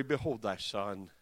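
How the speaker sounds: low, soft voice of dying Jesus; subdued, intimate low murmur; tender request. Retold or imitated. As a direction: drop low and quiet, entrusting a loved one. Voicing dying Jesus to John.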